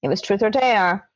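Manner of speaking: ordinary talk, nothing marked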